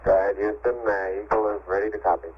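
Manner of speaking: crackly radio transmission; over walkie talkie. Real, not acted. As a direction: voice coming through a radio link. Astronaut radio transmission from spacecraft.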